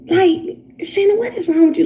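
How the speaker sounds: high, incredulous outburst; exasperated. Real, not acted. Streamer scolding Shannon Sharpe, high exasperated outburst. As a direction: throw up your hands at him.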